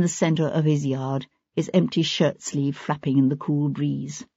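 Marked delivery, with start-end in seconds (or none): none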